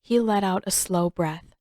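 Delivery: ordinary delivery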